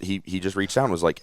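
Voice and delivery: usual speaking voice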